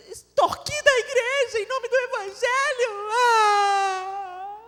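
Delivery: high, loud protest breaking toward tears; indignant outburst. Retold or imitated. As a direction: rail against the unfairness, voice cracking. Retold outburst at parents' table.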